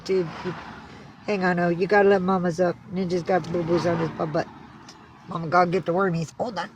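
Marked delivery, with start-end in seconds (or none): none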